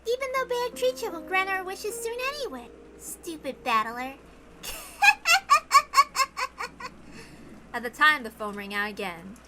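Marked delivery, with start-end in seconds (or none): 0.1-4.2 put-on high, eerie child voice; sing-song, unsettlingly cheerful child; creepy little girl. Maria tells Battler she'll revive her mama, eerie and high. 4.6-7.7 put-on high, eerie little-girl giggle; unsettling childish cackle; creepy giggle. Maria laughs at Battler, high and eerie.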